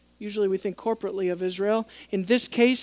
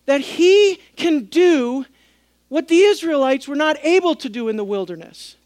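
raised, louder preaching build; fervent preaching; 5 semitones higher, 6 dB louder than usual. Acted. Pastor preaching to his congregation, fervent and raised.

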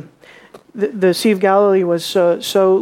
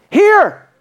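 sharp high shout of Here; shouted command, high and loud; exclaimed; 11 semitones higher, 5 dB louder than usual. Shown as a demonstration. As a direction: call it out like a command. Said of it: "Exclamation point".

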